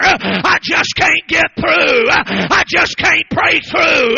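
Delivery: loud, slow, pressing preaching; loud preacher's pleading; preaching shout. Acted. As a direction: hammer each line to the crowd. Preacher at full force retelling.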